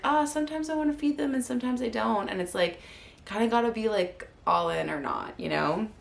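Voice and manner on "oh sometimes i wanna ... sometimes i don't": wishy-washy self. Retold or imitated. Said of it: "I was like".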